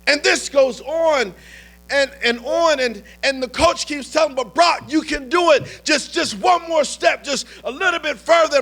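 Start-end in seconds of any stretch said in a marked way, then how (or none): 4.4-8.6 higher, raised coaching shout; urging shout. Retold or imitated. A coach shouting Brock onward across the field, high and urgent. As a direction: push him on like a sideline coach.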